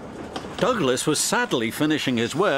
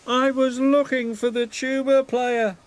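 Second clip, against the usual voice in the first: moaning; 11 semitones higher than usual. A narrator's reading. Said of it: "moaned Thomas".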